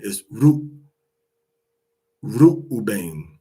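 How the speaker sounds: "Reuben" with a long oo, "Ru-" cut off then "Reuben" in full; "Reuben" the Hebrew way, oo plus bane; Hebrew pronunciation. Shown as a demonstration. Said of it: "it's supposed to be".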